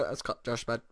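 ordinary delivery

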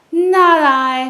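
cat refusing, loud and high. Acted. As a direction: toss it off as the cat.

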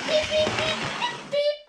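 very high, loud beeping; high engine whistle beeps. A narrator's reading. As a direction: imitate a little train whistling sharply. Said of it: "he would whistle".

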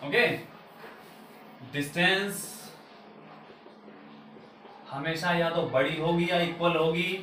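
ordinary talk, nothing marked

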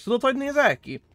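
ordinary delivery

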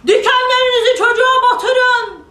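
very high, loud furious command; giant shouting. Acted. Giant orders the roses to prick the boy, shouting. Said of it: "Dev güllere bağırmış".

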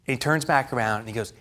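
usual speaking voice

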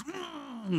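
high, drawn-out engine hum; imitated passing car; car engine imitation. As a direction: hum like a race car zooming in. Imitates cars zooming in.

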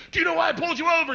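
high, loud, fast mock cop demand. Comic to nervous driver, mock cop. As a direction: bark it like a pushy cop. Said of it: "they're like".

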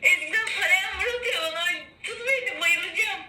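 high, loud, crying and gasping; tearful. Real, not acted. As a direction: near fainting, crying out. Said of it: "kıyamam, ağlıyor".

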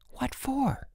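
put-on soft, high-pitched girl's question; hushed surprise. A narrator's reading. Girl questions Bruno from her window, hushed. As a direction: ask it high but hushed. Secret night talk at window.

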